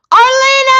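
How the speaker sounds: loud, high squeal of a name; real delighted scream of welcome; excited greeting. Real, not acted. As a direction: shriek hello to a friend who just showed up. Excitedly greeting arriving friend.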